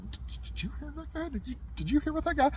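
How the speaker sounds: high, hushed, disbelieving lawman's voice; a state trooper who can't believe his ears; startled trooper. Retold or imitated. Trooper asking the clerk about the guy, high and hushed. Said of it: "the state trooper's like".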